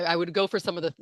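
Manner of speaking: normal talking voice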